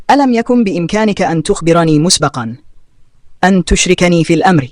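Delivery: plain reading voice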